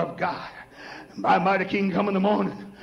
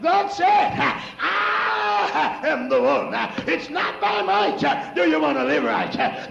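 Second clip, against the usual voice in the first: full-force preaching; 4 semitones higher than usual. Acted. Preacher urging the church to be holy, shouted preaching. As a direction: drive it home to the pews.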